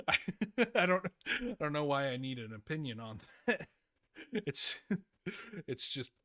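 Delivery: real chuckles between words; trying to talk while laughing; tickled, laughter breaking up the start; amused laughing. Real, not acted. Laughing at himself.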